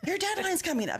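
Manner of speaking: high-pitched mock yell at staff; imitated editor's scream. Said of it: "coming out and screaming at people".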